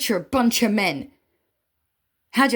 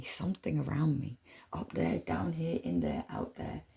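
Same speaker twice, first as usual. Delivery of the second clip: soft mysterious whisper; 4 semitones lower, 9 dB quieter than usual. Real, not acted. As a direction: drop into a whisper. Hushed mystical aside.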